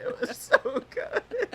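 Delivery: laughing and crying. Real, not acted. Sobbing while laughing.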